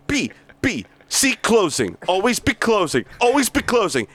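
hard-driving sales boss berating staff; bully the salesmen with contempt; reading Blake's tirade, pushy and mocking; aggressive sales boss. Plays Blake monologue.

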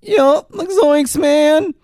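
high, loose stoner drawl; Shaggy voice. Retold or imitated. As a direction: hippie slacker voice, way up high. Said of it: "the Shaggy voice".

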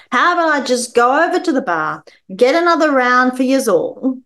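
put-on drunk dad. Drunk dad repeats offer.

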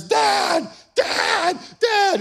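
very high, piercing imitation of a kid; squealed, urgent mock child's cry; child calling for dad. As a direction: mimic a panicked little boy calling. Imitates son calling out for him.

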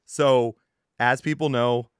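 usual speaking voice

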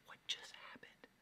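breathless whisper of disbelief; awed whisper. Retold or imitated. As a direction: whisper it like you can't believe it. Stunned moment retold in awe.

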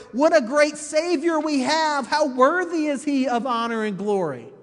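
loud, high preacher's praise; imitated celebration. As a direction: proclaim it like a worshipping crowd. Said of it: "God's people worship and they celebrate".

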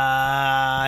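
loud, sustained "aaah" on one pitch; long held "ah". Pretend. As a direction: hold a loud, level drone to open the show. Sustained noise coming back from break.

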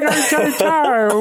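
very high, slow Jamaican gone Irish; hopeless accent attempt. Comic hamming the nurse's voice, hopeless Jamaican-Irish accent. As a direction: exaggerate a botched Jamaican accent. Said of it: "I'm not good with accent".

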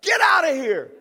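loud firm rebuke of the devil; firm command. Shown as a demonstration. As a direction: say it with a firm stance. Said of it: "take a stance and say".

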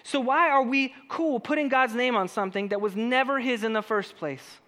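ordinary delivery

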